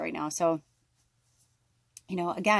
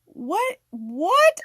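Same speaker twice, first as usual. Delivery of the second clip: high, rising disbelief, louder the second time; incredulous; 11 semitones higher, 7 dB louder than usual. Retold or imitated. Reacting to partner's baffling analogy, incredulous. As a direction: build from baffled to outraged disbelief.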